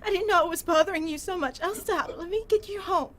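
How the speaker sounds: soft, broken apologetic murmur; tearful pleading. Acted. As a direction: plead gently through tears. Girlfriend comforting beaten boyfriend.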